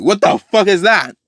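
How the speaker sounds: high-pitched bewildered exclamation; shocked outcry. Retold or imitated. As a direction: exclaim like a startled onlooker. Onlookers see blade falling from sky.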